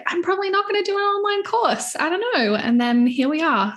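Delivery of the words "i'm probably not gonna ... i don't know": high-pitched, lightly acted reluctance; doubtful past self. Recalling her doubts about making an online course, mock-reluctant. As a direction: quote your own doubts in a higher voice. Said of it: "I was always like".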